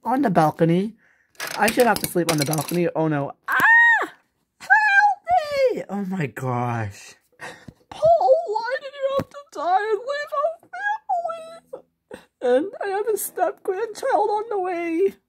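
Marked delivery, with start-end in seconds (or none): 3.5-5.8 high shrieking cry for help; scream for help. Pretend. Doll cries for help. 5.9-7.1 stunned and soft. Pretend. Realtor reacts, shocked. 7.9-15.1 put-on high, tearful old woman lament; high wailing widow. Grandma mourns dead husband.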